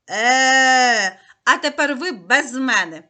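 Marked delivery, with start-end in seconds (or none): 0.1-1.2 "е" low and loud like a bear. Shown as a demonstration. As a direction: bellow a low "е". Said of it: "Високо, низько". (низьке голосне «е»)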